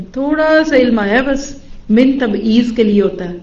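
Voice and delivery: normal talking voice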